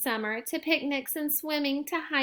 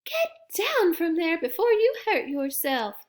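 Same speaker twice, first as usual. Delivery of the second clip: scolding granny; 6 semitones higher than usual. A narrator's reading. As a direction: scold him like a worried grandma. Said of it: "scolded Gran".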